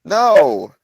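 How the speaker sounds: loud, high-pitched denial; shouting back. Acted. Traveler answers the chieftain in the cave, shouting back. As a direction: holler the answer.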